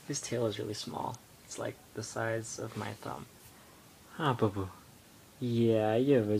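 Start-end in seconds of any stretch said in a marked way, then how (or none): none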